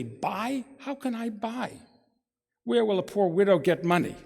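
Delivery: high, plaintive lament of a poor widow; bitter complaint. Acted. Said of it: "She complains bitterly".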